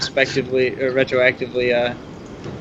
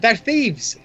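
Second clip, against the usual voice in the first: high-pitched half-shout at no great volume; mock shout; 10 semitones higher than usual. Player has the archer accuse the runners, half-voiced high shout. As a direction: pretend to call out an accusation. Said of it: "I'm gonna shout out".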